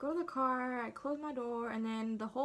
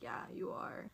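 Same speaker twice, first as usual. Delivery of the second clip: low, whispered reply imitating the doctor; hushed confirmation; 13 semitones lower, 9 dB quieter than usual. Doctor confirms the pregnancy to patient, hushed. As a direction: confirm it under your breath.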